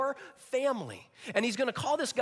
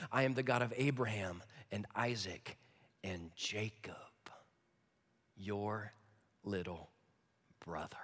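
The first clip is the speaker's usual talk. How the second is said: fading to whisper; 10 semitones lower, 6 dB quieter than usual. Acted. God's altered words, dropping to whisper.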